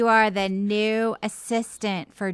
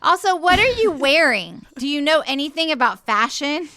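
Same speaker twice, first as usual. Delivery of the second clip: higher, catty boss; snippy Jesus; 3 semitones higher than usual. Acted. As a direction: sneer at the outfit. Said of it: "table turning Jesus".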